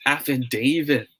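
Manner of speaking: "affidavit" with DA said as "day"; proper long A. Shown as a demonstration. Teacher reveals the proper way, long-A affidavit. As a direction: slowly say "affidavit" as af-fi-DAY-vit. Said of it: "The proper way to say this word".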